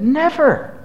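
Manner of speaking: a single ringing high "Never"; emphatic high Never. Real, not acted. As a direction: ring out "Never" as a final verdict. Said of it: "That's what Jesus said".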